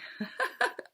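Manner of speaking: short airy laugh through tears; embarrassed laugh. Real, not acted. Tearing up on camera, breaking into an embarrassed laugh.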